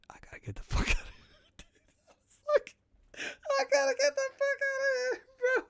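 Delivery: fast whisper with a laugh breaking in; panicked whisper. Retold or imitated. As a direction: whisper it to yourself like you need to escape. Panicked thought to self.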